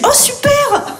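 high, chirpy mock receptionist voice; sugary mock gush at the front desk. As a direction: chirp it like a fake-cheerful clerk. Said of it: "elle m'a dit".

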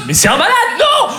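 very high, outraged exclamation; hammed-up alarmed pursuer; appalled outcry. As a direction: cry out in disbelief. Agent exclaims while chasing him.

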